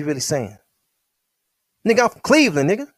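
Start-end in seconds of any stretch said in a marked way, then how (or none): 1.9-2.9 yelled boast. Retold or imitated. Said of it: "He's yelling this out down south".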